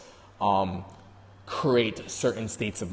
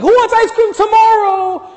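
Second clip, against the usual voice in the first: high excited yell; hyped-up shout; 16 dB louder than usual. Retold or imitated. Uncle bursting in at bedtime, loud excited shout. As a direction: blast it like the overexcited uncle. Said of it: "Even if we would've walked in and be like".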